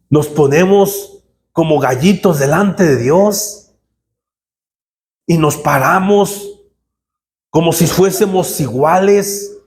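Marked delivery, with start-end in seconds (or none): none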